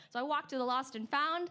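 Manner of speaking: ordinary talk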